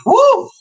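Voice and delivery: high joyful woo; loud excited cheer; 1 excited whoop. Real, not acted. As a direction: whoop it up to greet the viewers. Livestream host hyping up arrival.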